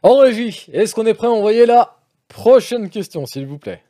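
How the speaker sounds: voix haute et forte de présentateur; interpelle la régie. Real, not acted. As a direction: lance l'appel vers la régie, haut perché. (appel sonore à la régie)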